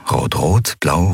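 ordinary delivery

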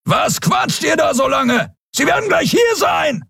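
high, urgent shout at companions; roaring. A narrator's reading. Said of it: "hörten sie Kero brüllen". (hohes, drängendes Brüllen)

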